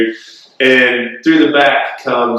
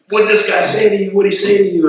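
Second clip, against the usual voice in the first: higher-pitched, mildly eager retold question; 10 semitones higher, faster than usual. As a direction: press for the answer, pitched up. Retelling friends quizzing him excitedly.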